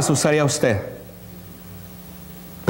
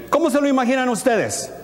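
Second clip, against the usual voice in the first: usual speaking voice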